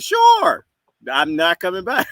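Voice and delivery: sharp high comeback to the clerk; mock loud retort. Telling off a checkout guy, mock loud retort. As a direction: toss it back loud and high. Retold reply to store clerk.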